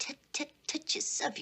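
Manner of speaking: mock tut-tutting, high. Mocking the tut-tutting back.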